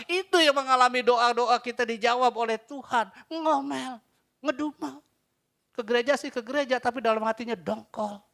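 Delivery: loud, high rebuke from the pulpit; fiery preaching. Acted. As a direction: preach it hard and rising. Preaching at full force. (khotbah berapi-api dengan suara keras)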